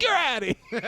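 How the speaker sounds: high, loud umpire-style call; triumphant ejection shout. Retold or imitated. Comic ejecting heckler as staff approach, triumphant shout. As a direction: yell it like throwing someone out.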